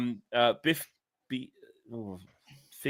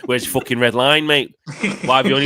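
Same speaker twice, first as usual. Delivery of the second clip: louder, sweary demand to a mate; forceful, exasperated challenge; heated challenge; 5 dB louder than usual. Retold or imitated. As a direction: hammer the question home with force. Heatedly repeats the question just read out.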